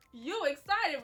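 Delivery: high, loud disbelieving question; asking her sister, stunned she's excited: loud, high surprise; surprised question. Retold or imitated.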